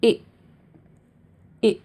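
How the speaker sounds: short lax "इ" as in bit; short i sound; short i demo. Shown as a demonstration. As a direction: clip the vowel "इ" short. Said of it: "उसे हम कैसे pronounce करेंगे".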